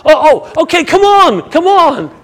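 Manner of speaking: loud, high, eager beckoning; hammed-up shout urging someone over; sudden change of tune. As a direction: switch to excited calling. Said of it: "They quickly change".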